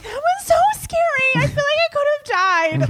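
very high, whimpering little voice; hammed-up high-pitched wail; mock tearful. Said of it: "I have to absolutely cry".

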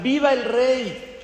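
high, slow, drawn-out cry of "¡Viva el rey!"; mock cheer. Tailor on the balcony hailing the king, high mock cheer. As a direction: call up to the passing king as a tailor would. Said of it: "y empieza a gritar".

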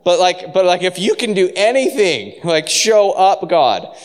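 high, loud appeal to God; desperate plea. Acted. Believer begging God to show up, loud and desperate.

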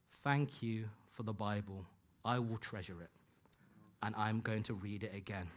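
hushed, sincere gratitude; heartfelt thanks. Retold or imitated. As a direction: quote it tenderly, letting it sink in. Reads out colleague's heartfelt thank-you email.

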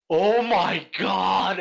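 loud, high, drawn-out amazement; amazed exclamation. Retold or imitated. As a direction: exclaim slowly and loudly in awe. Excited retelling of truffle gift.

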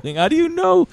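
high, cracking up incredulously; laughing disbelief. Real, not acted. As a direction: ask through real helpless laughter. Amazed at listener deciphering garbled voice.

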